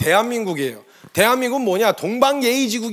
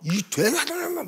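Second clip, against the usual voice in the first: garbled mock raging; frantic outburst; 7 semitones higher, 6 dB quieter, slower than usual. David struggles as people restrain him, imitated frantic outburst. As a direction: sputter it like someone being held back. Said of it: "막 흥분을 해갖고 막 난리를".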